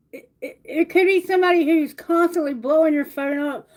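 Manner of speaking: normal talking voice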